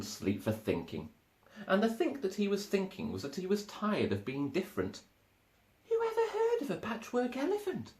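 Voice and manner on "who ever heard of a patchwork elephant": high, put-on Elmer voice, sad and wondering; Elmer's glum musing. A narrator's reading. Elmer thinking to himself, tired of being different, in a high sad voice. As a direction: muse it aloud, feeling left out. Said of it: "he thought".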